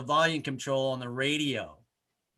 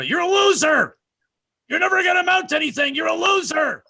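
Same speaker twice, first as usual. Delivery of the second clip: shrill screamed insults, much higher and louder; mock voices yelling; 14 semitones higher, 8 dB louder than usual. Voices in his head screaming insults, high loud yell. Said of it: "it's like, you know".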